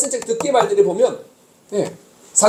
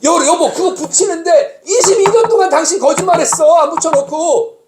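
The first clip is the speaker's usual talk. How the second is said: high, slow, angry with a bitter laugh; raised voice; 6 semitones higher, slower than usual. Retold or imitated. Husband scolding wife over years of broken promises, angry laughing. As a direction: scold her, half laughing in disbelief. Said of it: "제가 막 언성 높이면서 막 그렇게 화를".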